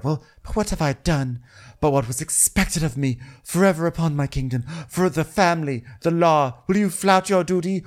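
hammed-up royal self-justification, working up to a tirade; mock aggrieved queen. Acted queen's aggrieved speech, building.

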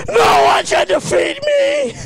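slow, high bellowing boast; triumphant shout. Real, not acted. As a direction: roar it like a champion. Drunk boast after a spill.

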